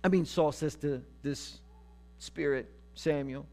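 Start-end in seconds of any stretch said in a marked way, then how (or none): none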